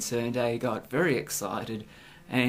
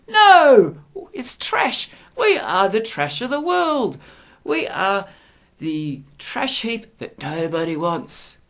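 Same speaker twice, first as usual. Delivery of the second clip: much higher, loud sneering "No!"; mock snobbish outburst; 9 semitones higher, 8 dB louder, slower than usual. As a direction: parody a sneering Brit. Mock Pommy disdain of Australia.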